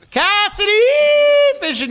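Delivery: excited shout. Real, not acted. Streamer calls out a big play.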